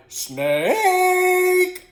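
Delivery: loud cry that jumps way up mid-word; frightened voice-breaking yell. A narrator's reading. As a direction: yell starting on one key and leaping high. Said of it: "When frightened, Wes's voice might begin".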